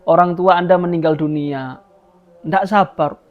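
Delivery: ordinary talk, nothing marked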